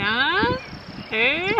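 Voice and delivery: Ah, eh? with a rising questioning tune. Shown as a demonstration. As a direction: ask it, pitch going up on eh. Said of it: "if we're having a question".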